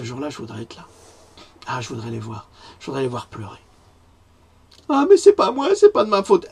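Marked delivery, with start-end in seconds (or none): 4.9-6.5 1 mock whining plea. Mimicking accused politicians pleading innocence.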